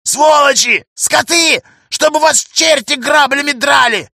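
high furious shouted curses; yelling curses. Acted. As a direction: spit out curses in rage. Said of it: "продолжает орать старичок". (яростные крики)

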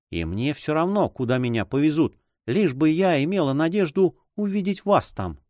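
high, yearning character voice; devoted heroine. Acted. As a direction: play a lady hoping to see her beloved.

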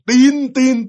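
high, held honking syllables; imitated car horn. Dad's taxi honking outside the house, imitated horn. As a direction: mimic a taxi horn beeping. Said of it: "bạn nghe cái tiếng kèn".

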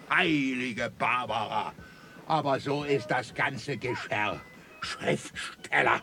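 low, quiet disgust; muttered, scornful despair; exasperated contempt. Acted. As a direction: sink low and soft in weary disdain. Commandant despairs of the writer.

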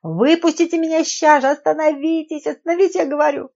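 high, loud frantic shouting; screaming demand. A narrator's reading. Trapped woman orders the driver to stop, screaming. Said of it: "закричала женщина".

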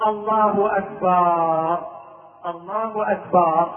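أكبر drawn out at the end as akbaar; slow mistaken takbir, أكبر ending in -baar; long final vowel. Shown as a demonstration. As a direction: add a long aa after the b of أكبر. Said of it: "إدخال ألف بعد الباء في كلمة أكبر".

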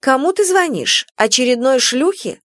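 high, accusing jealous wife. Acted. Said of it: "Супруга". (ревнивая жена, высоко)

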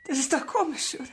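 bitter laughter breaking up high, then collapsing to a hush; unhinged giggle over something terrible; hysterical laugh. Acted. Grieving mother turns hysterical.

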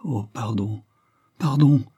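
urgent apology. A narrator's reading. Blanche seizes her friends' hands, urgently apologetic. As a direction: blurt the second apology quickly after checking yourself. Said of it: "ajouta-t-elle vivement".

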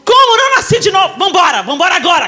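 angry mother calls her daughter to leave, shouting; furious like Maleficent. Retold or imitated. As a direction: storm out in outrage. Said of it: "Eu fiquei igual a Malévola lá".

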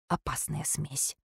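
usual speaking voice

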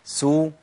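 سو rounded u; sin damma demo. Shown as a demonstration. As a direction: round the lips on سو. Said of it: "تحريك السين بالضم".

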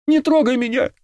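very high-pitched squeal of fear; panicked shriek. A narrator's reading. Girl jumping off the bed, panicked shriek. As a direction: shriek it, warding him off. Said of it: "взвизгнула Алена".

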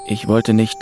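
ordinary delivery

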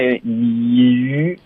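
drawn-out "鱼" with over-puckered lips; pouted ü. Retold or imitated. Teacher imitating student's tight pout, stretched "鱼". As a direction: pucker hard into a slow "鱼". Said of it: "你现在鱼这样".

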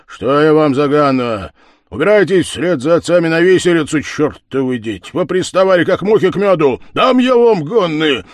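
loud, high, fast angry tirade; furious ranting. Acted. The head curses the lads away, loud and furious. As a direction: drive the lads off in a rage. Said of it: "кричал голова".

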